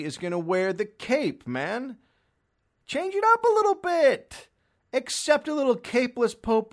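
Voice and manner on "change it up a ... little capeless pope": high, loud exasperated plea; high pleading rant; exasperated yell. Real, not acted. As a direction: shout it up high at them. Ranting at traditionalists.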